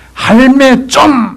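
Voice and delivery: loud, high, slow drawn Busan 할매 좀; Busan dialect. Retold or imitated. Preacher showing the three-syllable Busan request to grandma, loud Busan call. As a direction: call it out in broad Gyeongsang dialect. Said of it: "부산말이 뭔지 아나".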